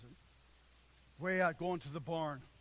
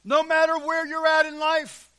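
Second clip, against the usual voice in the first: loud, high, rolling preacher's cry; fiery sermon delivery, voice ringing; full-force preaching; 10 semitones higher, 18 dB louder than usual. Acted. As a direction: thunder it out from the pulpit.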